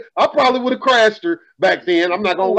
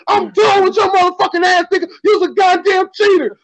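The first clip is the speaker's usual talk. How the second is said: put-on angry girlfriend; 10 semitones higher than usual. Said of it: "and she said".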